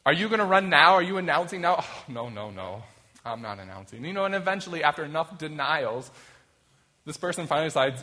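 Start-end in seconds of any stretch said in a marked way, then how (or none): 0.0-1.8 louder, higher reporters pressing; mock reporters calling out at an event; eager reporters. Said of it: "they're like". 1.8-4.0 coy denial. Retold or imitated. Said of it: "they're like".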